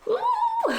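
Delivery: very high, loud "ooh"; excited squeal. Real, not acted. Vlogger unboxing her new printer, high excited squeal. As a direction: let out a piercing delighted ooh.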